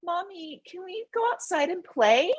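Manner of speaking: put-on a small kid pleading to go play; child's voice asking to go outside, high and hushed; inner child voice. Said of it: "my spirit and my inner child that's".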